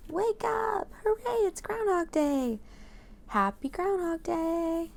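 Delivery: high, cheerful calls; excited calling. Acted. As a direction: call out to wake him up. Crowd calling to groundhog.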